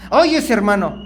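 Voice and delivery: loud weary "Ay, ese hermano"; exasperated complaint. Retold or imitated. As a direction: sigh it out in annoyance. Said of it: "te estás quejando del hermano".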